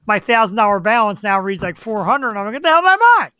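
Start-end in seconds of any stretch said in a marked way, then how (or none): 2.6-3.3 high, loud burst of disbelief; comic outraged yelp; mock outrage. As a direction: exclaim it like you just saw the bill. Acting shock at own balance.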